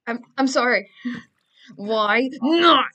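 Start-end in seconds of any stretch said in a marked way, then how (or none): 1.8-2.8 loud, high burst of protest; mock outraged yell. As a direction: build to a loud yell on the last word. Mock protest after joke apology.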